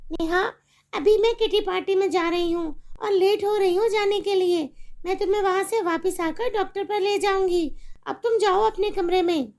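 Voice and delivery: a careless mother brushing off her daughter; irritated mother. Acted. Mother dismisses.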